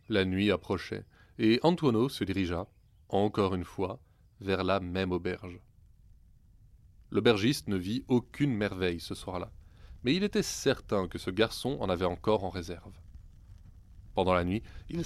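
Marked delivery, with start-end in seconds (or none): none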